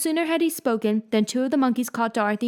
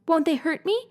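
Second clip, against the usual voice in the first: higher, worried little question; anxious; 7 semitones higher than usual. A narrator's reading. Dorothy worried the monkeys will hurt her, anxious. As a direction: ask it nervously, afraid of harm. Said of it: "asked the girl anxiously".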